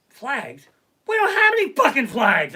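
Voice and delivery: loud high shout of disbelief; exasperated yell. Retold or imitated. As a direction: yell at the sleepwalking wife. Yelling at wife at 3am.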